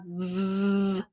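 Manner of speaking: long held vvv; V as a sustained lip-and-teeth buzz; buzzing V sound. Shown as a demonstration. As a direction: buzz the V before naming it. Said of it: "the V sound in 'em".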